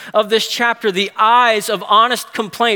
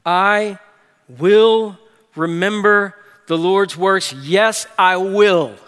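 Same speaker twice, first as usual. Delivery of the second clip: "will" drawn out and rising, resolute; stress on WILL; slower than usual. Shown as a demonstration. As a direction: lean into "will" in "I will remember the Lord's works". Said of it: "And that's the accent".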